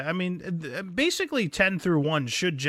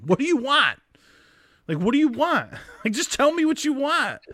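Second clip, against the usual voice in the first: high, raised, pleading demand; mock exasperation; 10 semitones higher, 4 dB louder than usual. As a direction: play a fed-up man begging her to just say it. Acted exasperated man demanding an answer.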